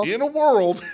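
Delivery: high, very loud overdone opener; mock trailer line, loud and high; hammed-up trailer announcer. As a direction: belt it out in a silly high voice. Answers being told he sounds country.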